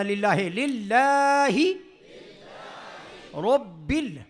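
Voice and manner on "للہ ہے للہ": للہ ہے with Urdu e, then clean للہ; wrong then right للہ. Shown as a demonstration. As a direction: contrast للہ ہے with للہ. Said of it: "للہ ہے نہیں پڑھنا". (للہ ہے غلط، للہ صحیح)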